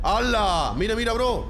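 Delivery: loud high amazed exclamation; excited. Real, not acted. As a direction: shout it, thrilled by the find. Spotting loot body in game.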